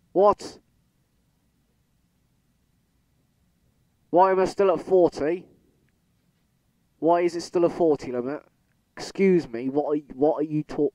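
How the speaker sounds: high, loud indignant questions; indignant disbelief. Real, not acted. Gamer yelling at the broken speed limit, loud indignant disbelief. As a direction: demand an answer from the game.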